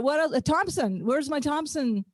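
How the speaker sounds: ordinary delivery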